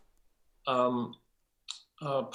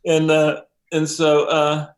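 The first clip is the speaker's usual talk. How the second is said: ordinary delivery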